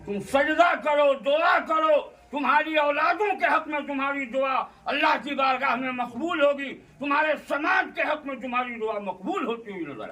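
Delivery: high, loud preacher's command easing at the end; impassioned exhortation. Acted. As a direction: urge the congregation fervently. Exhorts congregation to prostrate and pray.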